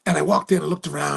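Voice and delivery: ordinary talk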